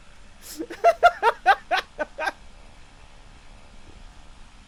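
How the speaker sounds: long burst of laughter; laughing. Real, not acted. Streamer cracks up after telling Cassie no, laughing. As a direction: laugh hard until it trails away.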